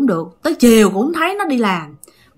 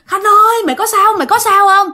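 urgent, high-pitched call to a friend; retold calling out; 10 semitones higher, 3 dB louder than usual. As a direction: call out in a much higher voice, acting alarmed. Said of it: "Trời ơi, cái bạn kêu". (giả giọng gọi bạn thất thanh)

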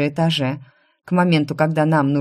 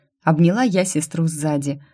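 usual speaking voice

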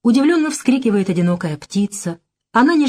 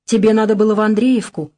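ordinary delivery, nothing marked

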